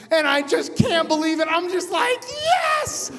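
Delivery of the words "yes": very high, triumphant yes; elated cheer. Retold or imitated. Passenger touching ground after surviving crash, elated. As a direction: let it leap up in celebration.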